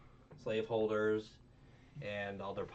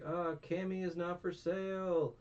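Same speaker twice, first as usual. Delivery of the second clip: much higher, light and breezy; Luffy voice; 9 semitones higher than usual. Retold or imitated. Luffy refusing the noble, high and light. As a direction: say it like an easygoing hero. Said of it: "he's like".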